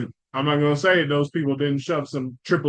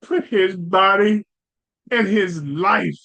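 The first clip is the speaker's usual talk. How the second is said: drawn-out, higher, louder mock-dramatic speech; mock solemn; 4 semitones higher, 4 dB louder, slower than usual. Host mocking the coach's tribute with hammed-up solemnity. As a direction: ham up the coach's solemn words, slowly.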